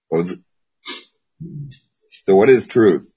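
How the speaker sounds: ordinary talk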